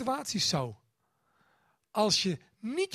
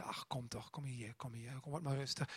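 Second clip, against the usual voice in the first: much lower and softer, hushing; tender comfort; 7 semitones lower, 13 dB quieter than usual. Retold or imitated. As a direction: soothe a crying child close up. Parent comforting child after nightmare.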